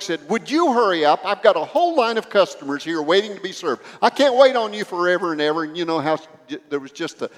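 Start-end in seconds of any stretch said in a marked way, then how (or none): 0.3-5.6 loud, high, rushed impatient snap at a customer; angry outburst. Retold or imitated. Store clerk snapping at a teenage girl, impatient angry outburst. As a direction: bark it like a fed-up clerk losing patience. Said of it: "the explosion took place".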